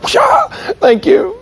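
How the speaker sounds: high, slow put-on thank you; very high, drawn-out meek woman; meek lady. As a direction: squeak out a timid thanks. Nice lady meekly thanking officer.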